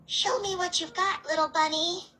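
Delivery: high, teasing sing-song taunt. Acted. Monster goading the rabbit to fight, teasing high. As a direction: goad him like a toy.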